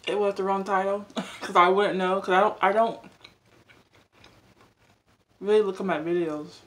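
chewing while talking; talking between bites; mouth full. Real, not acted. Seen 1: Takes forkful at 3.4s, then talks while chewing through the end.

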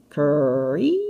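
drawn-out creaking door sound; slow, creaky imitation; imitated creak. A narrator's reading. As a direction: stretch the word and slide it like a hinge. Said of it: "The creaking sound came".

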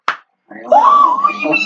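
very high, drawn-out squeal; long high-pitched game yelp; stretched shriek. Real, not acted. Shrieking mid-fight in game.

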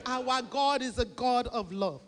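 usual speaking voice

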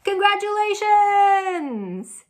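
high, loud, drawn-out congratulations; a big high celebratory call; cheering shout. Acted. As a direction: cheer it out for the kids. Celebrating the party.

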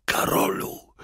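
exhausted rasp dying to a whisper; hoarse voice. A narrator's reading. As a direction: struggle out the words, voice giving out. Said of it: "chrypliwy głos Roberta".

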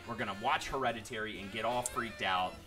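ordinary talk, nothing marked

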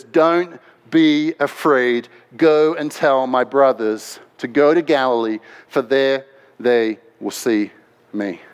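low, slow, measured comfort; slow right down and settle their fear; calm reassurance. Acted. Jesus sending word to his brothers, calmly reassuring.

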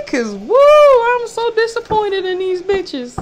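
very high, loud, exasperated wail; high loud disappointment. Real, not acted. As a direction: cry it out up high. Exclaiming disappointment.

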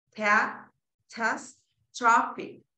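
"catastrophic" very slowly, a gap between syllables; syllable-by-syllable reading. Shown as a demonstration. Teacher repeating what the transcription says, slow separate syllables. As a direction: sound out "catastrophic" one piece at a time. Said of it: "Eso es lo que dice".

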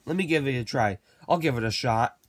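usual speaking voice

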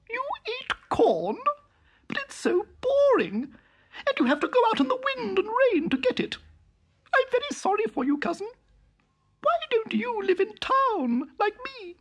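very high mouse voice, looking down on it; snooty town mouse. A narrator's reading. Town mouse sneering at her cousin's hedge, very high. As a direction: pipe it up high like a prim little mouse.

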